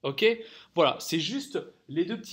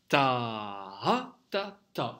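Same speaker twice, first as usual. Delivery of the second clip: long low "Ta" pulsed up on "ha", then short "ta, ta"; stress on the dot; 4 semitones lower, much slower than usual. Shown as a demonstration. Teacher models noire pointée croche, accented dot. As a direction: stretch "Ta", accent "ha", clip off two "ta". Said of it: "On insiste bien sur le point".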